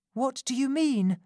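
pleading Rose. A narrator's reading. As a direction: ask her not to go back. Rose asking.

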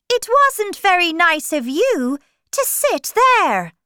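high, loud storybook scolding; indignant retort. A narrator's reading. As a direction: throw the reproach back, loud and high. March Hare retorts to Alice.

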